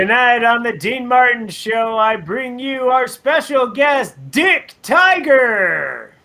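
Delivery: high, drawn-out big introduction; show announcer. Acted. Announcer introduces [name]'s special guest, grand showman delivery.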